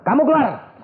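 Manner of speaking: sudden high order; expelling command. Retold or imitated. Retelling how he cast the demon out, sudden command.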